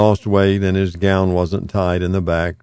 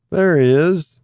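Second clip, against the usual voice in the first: bright, lifted cheerful hello; cheerful greeting; 9 semitones higher, 3 dB louder than usual. A narrator's reading. Old man greeting his visitor, cheerful. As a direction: greet him like a happy surprise. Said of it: "He sounded cheerful".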